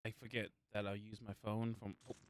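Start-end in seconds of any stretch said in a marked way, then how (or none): none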